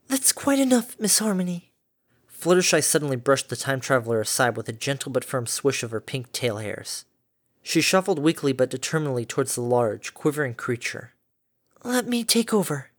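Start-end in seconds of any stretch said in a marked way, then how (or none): 0.1-1.7 1 gentle firm rebuke. A narrator's reading. Fluttershy firmly stops Harmony. 11.8-12.9 1 timid resolve. A narrator's reading. Fluttershy steps in.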